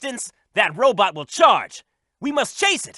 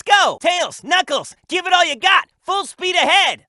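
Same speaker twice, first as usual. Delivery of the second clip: rallying shout; 7 semitones higher than usual. Acted. Leader shouts to Tails and Knuckles to push, rallying shout. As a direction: fire up your teammates, full voice. Rallying teammates mid-race.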